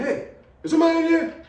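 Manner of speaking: high, loud call with a nervous laugh. Retold or imitated. Calling out to an intruder in the empty house, a loud nervous shout.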